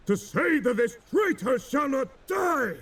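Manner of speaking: high, ringing shout of command; Drake's challenge. A narrator's reading. Drake shouting his law at his captains, commanding shout. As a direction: bellow it down at the cowed captains.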